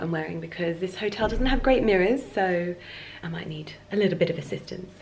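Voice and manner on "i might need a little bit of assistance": soft whispered aside; whispering. Real, not acted. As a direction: whisper it, half to yourself. Whispered aside about needing help.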